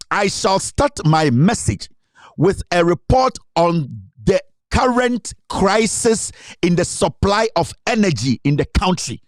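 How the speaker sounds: slow it right down like reading a state speech aloud; slow, deliberate quote reading. Retold or imitated. Commentator reading Kufuor's speech to listeners, slow and deliberate. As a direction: read Kufuor's words slowly, word by word. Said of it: "Ɔse".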